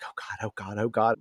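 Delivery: a hint of hushed panic; panicked murmur. Cordelia freaking out hidden inside.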